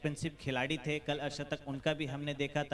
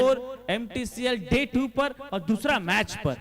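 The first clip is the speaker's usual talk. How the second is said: loud, high match announcement; announcer voice; 6 semitones higher, 8 dB louder than usual. Acted. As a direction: call it out to the crowd.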